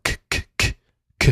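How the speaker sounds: bare K, a voiceless puff off the tongue; kaf k sound. Shown as a demonstration. Teacher giving kaf's initial sound, crisp unvoiced K. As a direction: repeat a short whispered K, no vowel. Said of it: "Is a k sound".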